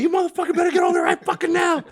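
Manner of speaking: high, loud cussing scream at his brother; reliving the rage, scream it out at full force; furious retold yell. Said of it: "I'm yelling at my brother in the walkie-talkie".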